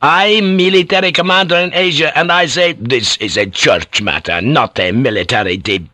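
heated officer's tirade; storming. A narrator's reading. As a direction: argue furiously, pulling rank. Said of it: "Ferriera stormed".

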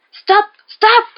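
two high, very loud screams; yelled. A narrator's reading. Ron ordering the falling car to halt, yelling. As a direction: yell each word at full force. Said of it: "hurla-t-il".

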